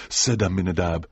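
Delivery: usual speaking voice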